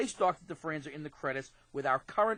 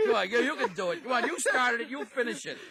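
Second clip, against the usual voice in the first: laughing coaxing; 6 semitones higher than usual. Real, not acted. Coaxing brother through laughter.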